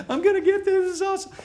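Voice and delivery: high, loud burst of excitement; all excited. Retold or imitated. Imagined recruit squealing with excitement over the offer. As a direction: squeal like a thrilled new recruit. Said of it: "their eyes are gonna get big and they're all excited".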